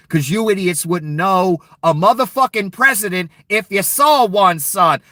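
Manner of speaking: rising angry tirade at voters; angry shout. Real, not acted. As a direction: voice climbing into a real furious yell. Furious rant at Trump voters.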